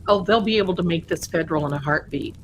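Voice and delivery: usual speaking voice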